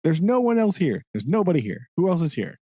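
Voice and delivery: lightly voiced hotel clerk insisting nobody's here; imitating the hotel guy. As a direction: play the clerk brushing it off lightly. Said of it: "he keeps being like".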